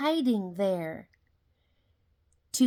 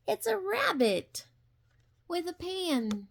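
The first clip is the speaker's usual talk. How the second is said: higher, delighted discovery; surprised reveal; 8 semitones higher than usual. Acted. Showing the child the rabbit, surprised. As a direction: gasp it out as a surprise.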